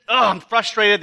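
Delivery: usual speaking voice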